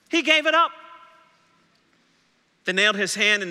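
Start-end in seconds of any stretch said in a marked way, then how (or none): 0.1-0.7 high, loud proclamation; emphatic cry. Acted. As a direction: ring it out, louder. Preacher climaxes on the sacrifice.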